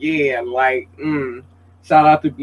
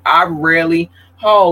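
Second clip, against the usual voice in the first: normal talking voice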